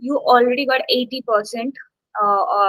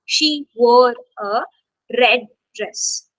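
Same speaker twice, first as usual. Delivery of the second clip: slow build, then RED set apart after a pause; stress on RED; slower than usual. Shown as a demonstration. Teacher showing emphasis on adjectives, stress on RED. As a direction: hold back, then land firmly on RED. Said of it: "You're emphasizing on adjectives".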